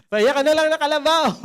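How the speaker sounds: high, loud teasing shout from the crowd; a bystander mocking the pastor with a loud, high shout; jeering crowd. Crowd joking, voice shifted.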